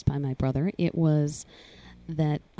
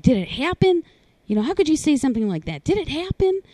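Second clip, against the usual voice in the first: much higher, louder pleading shout; imitating a frantic mother, higher and louder; frantic mom; 10 semitones higher, 6 dB louder, faster than usual. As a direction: mom demanding the truth at full pitch. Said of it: "they're just screaming at me".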